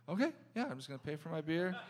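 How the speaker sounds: high, soft, apologetic imitation; overly nice customer. Customer to waiter, high and soft mock-polite excuse. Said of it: "the nicest, weirdest guys".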